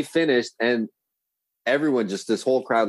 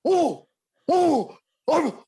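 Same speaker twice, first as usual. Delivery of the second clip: much higher, panicky stuttering; flustered stammer; much slower than usual. Retold or imitated. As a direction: caught out, sputter in a high voice. Buddy panics at girl's age.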